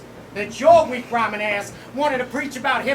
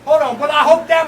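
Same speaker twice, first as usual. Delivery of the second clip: loud; 3 semitones higher, 11 dB louder than usual. Acted. Rapper restarts line, loud.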